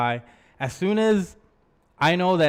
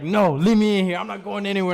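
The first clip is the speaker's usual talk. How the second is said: put-on louder, higher defiant drunk whine, quoted back by his brother; imitating a drunk protest; 6 semitones higher, 4 dB louder than usual. Brother mimics the blacked-out speaker refusing to leave the car, louder drunk whine. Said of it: "and you're like".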